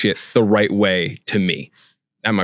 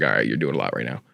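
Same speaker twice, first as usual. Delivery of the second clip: God's voice; 9 semitones lower, 5 dB quieter than usual. Retold or imitated. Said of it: "God's gonna be like".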